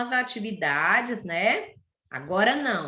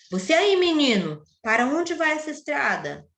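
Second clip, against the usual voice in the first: put-on higher-pitched storybook hail to a child; read-aloud character question, raised pitch; vicar calling out; 5 semitones higher than usual. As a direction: call the boy over as the story's vicar. Vicar calls out to boy in story.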